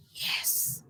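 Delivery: retold secret yes, whispered. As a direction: whisper it like a private little victory. Retold private satisfaction at his jailing.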